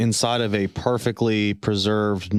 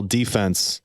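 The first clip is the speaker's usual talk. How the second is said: "defense" with DEE drawn out and stressed; stress on first syllable. Shown as a demonstration. Explaining the sports term to the group, "defense" stressed on DEE. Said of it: "emphasis on the different syllable".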